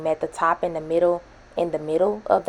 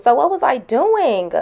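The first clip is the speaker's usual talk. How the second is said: incredulous; 8 semitones higher, 10 dB louder, slower than usual. Real, not acted. Laughing at own mistake.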